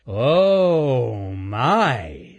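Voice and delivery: long-drawn loud "oh" sliding down in wonder; awestruck chipmunk. Acted. Chipmunk spots the candy stack, drawn-out amazement. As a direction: stretch it out, amazed.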